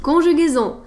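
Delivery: Conjugaison called out loud and high, falling at the end; announced heading. Shown as a demonstration. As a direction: announce the new section, Conjugaison, like a title.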